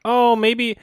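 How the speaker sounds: mock Trump. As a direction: ham up a dithering president. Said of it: "Trump is like".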